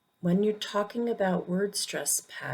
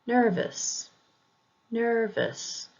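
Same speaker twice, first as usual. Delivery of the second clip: "nervous" with a high NER, falling on the weak ending; "nervous" pronounced as a demo, high on NER; first-syllable stress; 5 semitones higher, slower than usual. Shown as a demonstration. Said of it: "emphasis is usually on the first".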